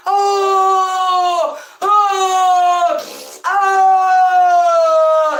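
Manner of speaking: high, loud whooping shouts; hammed-up drunk fans bellowing at the TV; drunk football yell. Drunk boys yelling at the football game, loud whoops. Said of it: "the boys are really drunk and they're like".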